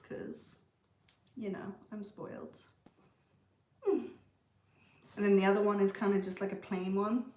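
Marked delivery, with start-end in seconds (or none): none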